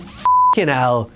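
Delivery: slow, put-on groan of disbelief; mock exasperation. Reacting to Shane as ref, slow mock exasperation. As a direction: drag it out in disbelief.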